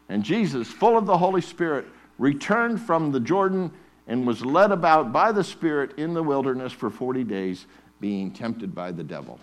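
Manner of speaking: rising preacher's cadence, loud on the Spirit; preaching. Acted. Preacher reading Luke to the congregation, fervent. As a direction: preach the scripture with building fervour.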